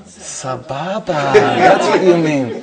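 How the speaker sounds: "Sababa" stretched out very slowly; slow, amused emphasis on "Sababa"; dawning recognition. Retold or imitated. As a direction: draw out "Sababa" as the word clicks. Acts out his dawning recognition.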